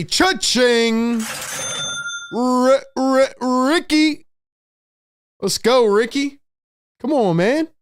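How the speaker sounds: high, whooping play-by-play call; excited celebration. Real, not acted. Streamer cheering Ricky Council's three, excited celebration.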